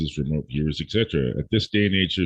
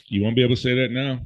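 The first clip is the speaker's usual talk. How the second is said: ordinary talk, nothing marked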